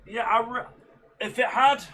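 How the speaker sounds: yawning through the word. Real, not acted. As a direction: say it mid-yawn. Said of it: "Sorry guys if I'm yawning".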